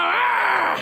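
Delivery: high, shouted "ah"; hammed-up aggressive yell; mock war cry. Woman demonstrating her aggressive approach, mock war cry.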